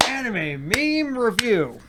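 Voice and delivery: high, drawn-out show intro shout-out; Brandon Herrera. Acted. Host opens the show in homage to a YouTuber, hammed-up impersonation. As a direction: stretch the title out like a hammy host. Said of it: "Brandon Herrera impersonation".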